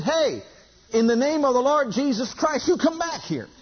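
high-pitched retold command; commanding call. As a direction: call him back with preacher's authority, pitch climbing. Retold command called after fleeing robber.

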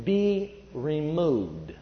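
a weak, dragging order to the mountain; slow, drawn-out command. Retold or imitated. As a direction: stretch "Be removed" very slow and low. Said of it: "coming out of your spirit".

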